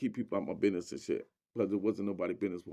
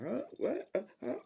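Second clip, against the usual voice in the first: much higher, baffled huh and what; lady driver confused by rowdy neighbors, much higher startled voice; startled confusion; 13 semitones higher than usual. Retold or imitated. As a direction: act thrown by the crowd.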